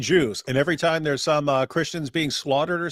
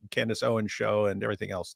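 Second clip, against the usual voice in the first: ordinary delivery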